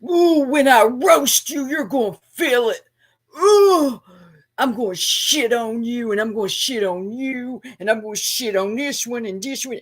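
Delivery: exaggerated, wailing mockery of his trash talk; mocking impersonation. Mimicking Matthew's same old live roast, mocking sing-song. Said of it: "you'll be doing that same old".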